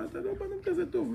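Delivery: wife telling her husband he is not so good; wife's voice. Retold or imitated. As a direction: pitch up into the wife putting him in his place. Wife's voice in acted quarrel.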